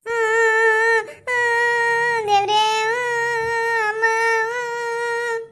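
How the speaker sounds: drawn-out sung mock wail; fake wailing. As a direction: hum a long hammed-up cry. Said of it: "ಜೋರಾಗೆ Michael ಹತ್ತಂಗ್ ಅಳ್ತಾವಳೆ".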